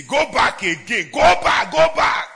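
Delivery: "Go back again, go back, go back" rising to a high shout; heaven's shouted command. Acted. Preacher voicing heaven as it sends a minister back, shouting.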